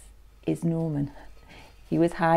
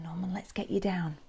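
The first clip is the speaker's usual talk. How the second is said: usual speaking voice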